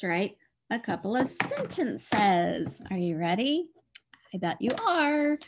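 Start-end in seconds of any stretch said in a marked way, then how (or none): 4.3-5.4 playful encouragement. Real, not acted.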